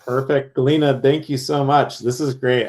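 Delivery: usual speaking voice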